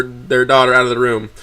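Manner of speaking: plain reading voice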